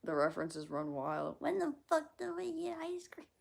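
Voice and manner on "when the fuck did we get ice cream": very high, put-on voice; The Ringer impression. Streamer repeating a Ringer line, high character voice. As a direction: do the movie line high and childlike. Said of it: "that's from The Ringer".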